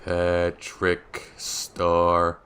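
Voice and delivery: very slow, soft and spaced out; drawn-out murmur while searching; absent-minded typing. Real, not acted. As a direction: say it piece by piece as you type.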